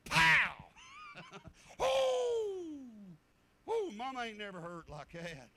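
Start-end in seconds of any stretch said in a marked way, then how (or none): 0.0-0.7 loud, sharp imitated paddle hit; loud mock smack sound; paddle crack. Paddle strike acted out. 0.8-1.8 burst of laughter. Real, not acted. Laughs at the paddling story. 1.8-3.2 put-on loud, high "ooh" of a stung kid; hammed-up pained howl; mock pain yelp. Reacts to paddle pain.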